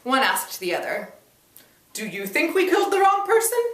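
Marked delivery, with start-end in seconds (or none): 1.9-3.6 high, mocking, amused sneer; a smug uncle mocking the reporter, high and sneering; haughty mocking question. A narrator's reading. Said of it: "haughtily amused by my questions".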